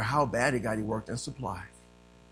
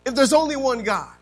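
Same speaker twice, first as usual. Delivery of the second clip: loud, raised preaching voice; forceful preaching; 10 semitones higher, 14 dB louder than usual. Real, not acted. Preacher telling church of his search for God, forceful preaching.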